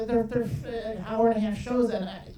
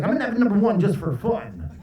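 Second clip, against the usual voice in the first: DJ patter at a drive-thru; radio DJ voice; 3 dB louder than usual. Acted. DJ ordering lunch at the drive-thru, hyped radio voice. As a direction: order food like you're on air. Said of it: "They really talk like that".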